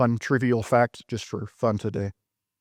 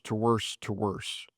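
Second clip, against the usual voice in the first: fading away; 3 semitones lower, 7 dB quieter, slower than usual. Acted. Repeating worse, voice sinking away.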